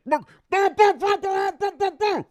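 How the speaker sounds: high, loud muffled babble through a mask; muffled masked talk. Retold or imitated. Mocking a masked passenger answering the flight attendant, loud high muffled babble. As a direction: mimic a passenger mumbling unintelligibly behind a mask. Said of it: "And they'd be like".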